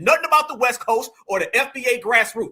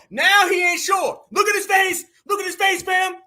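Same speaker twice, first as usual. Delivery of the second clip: high, loud mocking shout; 7 semitones higher, 4 dB louder than usual. Real, not acted. Streamer mocking the chairman to his chat, loud shout. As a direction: crow at him to the chat.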